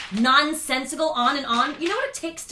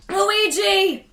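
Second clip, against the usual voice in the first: loud call for help; 8 semitones higher, 8 dB louder than usual. Acted. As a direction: shout the name across the room. Teacher calls for help.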